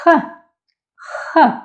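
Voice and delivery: H as a hard, raspy throat sound with a short vowel; strong Russian H. Shown as a demonstration. Teacher introduces the last letter to learners, strong Russian H. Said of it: "stronger than English H".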